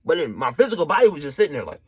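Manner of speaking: ordinary delivery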